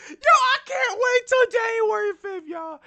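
high, loud thrilled yell; excited shout. Real, not acted. Host hyping January fifth to viewers, excited shout. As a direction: shout it out, bursting with anticipation. Thrilled about upcoming episode.

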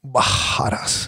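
Bajadas said slowly, with the double R as a breathy H; Portuguese pronunciation. Shown as a demonstration. Asking how the gym name Bajadas is said. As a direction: say Bajadas the Brazilian way, H sound for RR. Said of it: "you fucking pronounce it with, like, it's a H".

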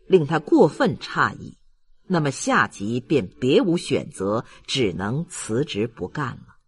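usual speaking voice

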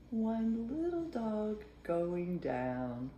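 ordinary delivery, nothing marked

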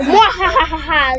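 slow, read-out villain cackle; half-hearted "mwahaha" in own pitch; mock evil laugh. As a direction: spell out an evil laugh, slowly.

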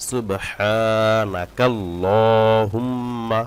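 slow, drawn-out Arabic recitation; slow recitation demo. Shown as a demonstration. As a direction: recite each sound slowly, stretching the vowels. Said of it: "পড়তে পারেন". (ধীরে টেনে টেনে তিলাওয়াত)

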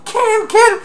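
very high, loud, cartoonish shouted threat; furious burglar threat. Burglar threatening the kid, high mock shout. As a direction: scream the threat like a crazed villain. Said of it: "And I think he said".

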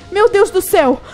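normal talking voice

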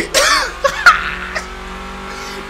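breathless stunned laugh. Real, not acted. Stunned after car appears.

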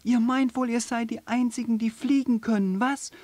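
hoch und laut wütend. Acted. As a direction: schimpf voller Wut. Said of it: "so wütend, wie schon lange nicht". (wütendes Känguru, laut)

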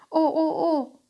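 high, loud, breaking dismay; a child's shocked cry of worry; little girl's loud dismayed oh. A narrator's reading. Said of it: "sagt Nele".

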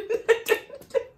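high breathy giggle; high-pitched laughing fit. Real, not acted. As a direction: crack up at your own singing. Cracks up after singing theme.

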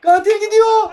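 shouted high and loud; excited shout. Real, not acted. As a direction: yell it out excitedly. Repeats line, second time shouted.

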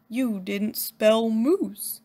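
a lightly voiced big slow moose; slow dopey moose. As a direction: drawl it out like a simple friendly moose. Moose character speaking.

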